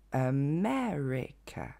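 America étiré syllabe par syllabe, plus grave; découpe lentement America à l'anglaise. Shown as a demonstration. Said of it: "Lentement, ça nous donne". (modèle lent, ME allongé)

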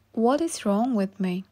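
usual speaking voice

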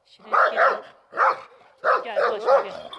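high call to the dog from afar. Real, not acted. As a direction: call her back, high and carrying. (köpeği uzaktan yüksek sesle çağırma)

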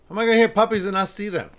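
loud, high, fast complaint; exasperated. Real, not acted. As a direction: blurt it out in disbelief. Hears puppies but none seen.

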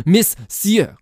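"Monsieur" yelled in two beats, "miss-YUH"; very high, exasperated "Monsieur" split into syllables; emphatic corrected pronunciation. Acted. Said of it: "not Monsieur".

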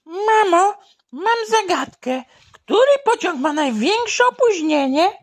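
put-on high excited child; high boy voice. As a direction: pose a riddle like a small boy. Boy asks mother a riddle.

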